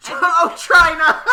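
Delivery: very loud, very high and slow, laughing it out; laughing shout. Real, not acted. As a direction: howl the name in delighted disbelief. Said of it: "Yeah, exactly".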